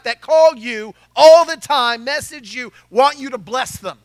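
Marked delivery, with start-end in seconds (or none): none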